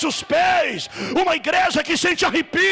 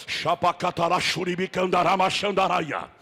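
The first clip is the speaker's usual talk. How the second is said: speaking in tongues; 7 semitones lower, 3 dB quieter than usual. Real, not acted. Prays in tongues at worship.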